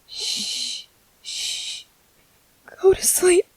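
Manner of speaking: unvoiced whisper; whispered hush. Parent whispering to a baby to sleep.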